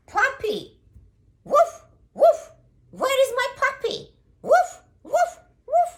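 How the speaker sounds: very high, loud yapping call; squealed, urgent dog mother; mama dog calling. Acted. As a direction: call out frantically for a lost pup, with barks. Mama dog calls lost puppy.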